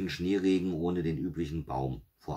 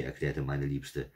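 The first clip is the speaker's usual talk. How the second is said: ordinary delivery, nothing marked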